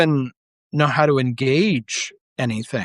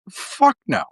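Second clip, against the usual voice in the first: high, scornful blurt; emphatic scorn; 10 semitones higher than usual. Real, not acted. Answering his own question about Yale with a high scornful no. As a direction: spit out a flat refusal.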